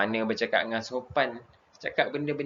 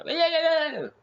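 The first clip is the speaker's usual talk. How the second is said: "oi" yelled much higher and louder; hammed-up rude yell; mock snapping shout; 4 dB louder, faster than usual. Shown as a demonstration. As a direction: bark it like scolding a friend. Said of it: "macam nak tengking je".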